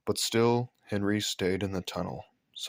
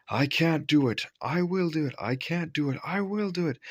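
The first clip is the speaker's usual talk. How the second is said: puffing together; 7 semitones higher than usual. A narrator's reading. Gordon and Edward puffing together up the hill, high and rhythmic. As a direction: puff it like two engines in rhythm. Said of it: "they puffed together".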